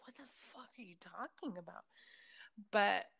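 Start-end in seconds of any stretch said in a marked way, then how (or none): 0.1-1.9 hushed incredulous mom; mom whispering. Retold or imitated. Mom reacting to premonition talk.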